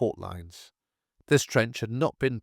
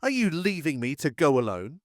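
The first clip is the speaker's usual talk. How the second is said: shouting; 6 semitones higher than usual. A narrator's reading. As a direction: hurl it at them, stunned they won't move. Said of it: "He shouted".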